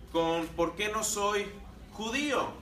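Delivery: ordinary talk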